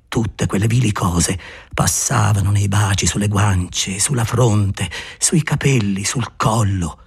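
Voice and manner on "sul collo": ordinary delivery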